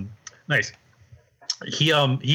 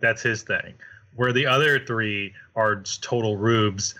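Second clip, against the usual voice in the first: ordinary delivery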